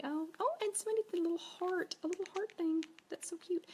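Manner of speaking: high-pitched, delighted squeal of thanks; sudden high, sweet delight; delighted cooing. Real, not acted. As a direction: gush over something cute, high up. Delighted by a viewer's heart.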